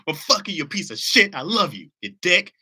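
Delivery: high, loud mock cussing with love; loving cuss out. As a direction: curse them out fondly and loudly. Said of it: "Give 'em the most loving cuss out".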